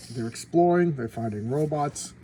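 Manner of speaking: normal talking voice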